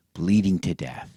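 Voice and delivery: ordinary delivery, nothing marked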